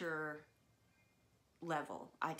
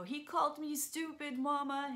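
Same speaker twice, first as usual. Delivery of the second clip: put-on high-pitched child tattling to mama; upset little kid; 10 semitones higher, 4 dB louder than usual. Crying child telling mom on brother, high upset whine. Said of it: "in my little eight or nine-year-old way".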